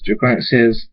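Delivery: ordinary delivery, nothing marked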